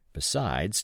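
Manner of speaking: ordinary delivery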